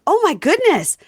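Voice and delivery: shocked gasp. Retold or imitated. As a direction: blurt it like a stunned innocent. Husband shocked in retelling.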